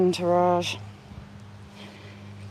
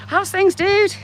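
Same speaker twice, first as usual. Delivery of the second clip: cheery greeting; 11 semitones higher, 7 dB louder than usual. Pretend. Greeting a grave like a friend.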